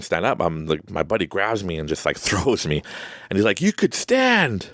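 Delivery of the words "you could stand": high, drawn-out mock yell; exasperated calling out. Buddy to panicking friend in shallow water, slow high holler. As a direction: call it out slowly, pitched way up.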